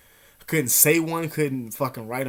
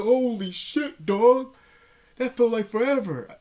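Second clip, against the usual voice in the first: much higher, slow, disbelieving holy shit; amazed relief; 9 semitones higher, 5 dB louder, slower than usual. Retold or imitated. The clock finally changed, high amazed relief. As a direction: stretch it out in disbelief.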